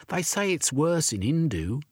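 put-on a boy repeating dark rumours he heard; gossiping urchin boy.

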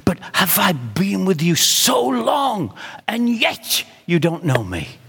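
pleading. Acted. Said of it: "he said to his disciples".

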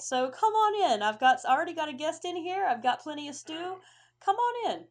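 big booming greeting with a smile in it; smiling dragonborn. Acted. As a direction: call the guests inside, loud and high. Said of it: "he is smiling at you".

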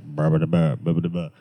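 lightly imitated presidential cadence in nonsense syllables; a hint of a low politician's babble; Obama. Shown as a demonstration. Showing co-hosts a harmless impression, lightly imitated presidential cadence. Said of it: "if I did an Obama".

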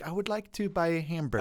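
lightly voiced accent coach; dialect coach. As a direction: model the sentence neatly for a pupil. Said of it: "And she's like".

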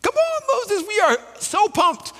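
squealing high-pitched mock excitement; over-the-top cheering, pitched very high; hyped crowd. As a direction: play the thrilled crowd, way up high. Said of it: "everyone's like".

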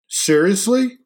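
high, loud, offended question; not happy. A narrator's reading. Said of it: "He was not happy".

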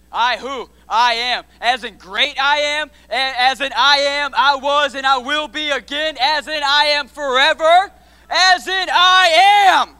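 rising from firm to a slow roaring climax; preacher building to a shout. Acted. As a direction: swell until the last I am explodes.

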